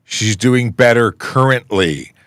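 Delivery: milk every word of the pun; drawn-out punchline. Acted. Reading dad joke punchline aloud.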